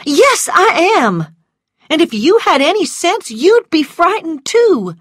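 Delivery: high, loud, heated retort; frightened, indignant woman. Acted. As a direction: snap back in a frightened temper. Voices frightened Scarlet retorting.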